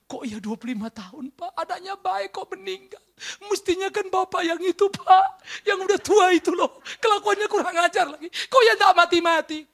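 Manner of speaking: put-on high, half-laughing grumble about the old man; mock complaint. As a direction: whine it as a gossiping mourner.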